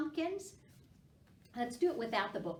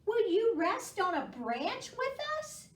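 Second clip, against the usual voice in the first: higher, louder bird asking; 12 semitones higher, 6 dB louder than usual. A narrator's reading. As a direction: invite like a chirpy bird. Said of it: "said Bird".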